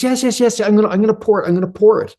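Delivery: high, fast, flustered voice of a man who can't pour; anxious stuck man. Retold or imitated. Man who can't pour the coffee, refusing help in a high flustered voice. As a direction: play a nervous guy insisting he must do it himself.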